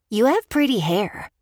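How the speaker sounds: put-on a little boy complimenting a stranger, innocently; sweet little boy. A narrator's reading. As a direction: say it like a curious young boy. Boy speaks to Paige.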